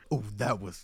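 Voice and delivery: usual speaking voice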